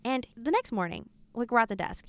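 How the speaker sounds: usual speaking voice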